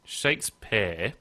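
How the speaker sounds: "Shakespeare" said slowly as shakes-pear; slow "Shakespeare" with a distinct "pear" ending; Shakes-PEAR, like the fruit. Shown as a demonstration. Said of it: "like, like a pear".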